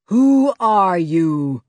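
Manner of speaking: slow, loud, menacing boom. A narrator's reading. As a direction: boom it down from above. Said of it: "boomed the voice".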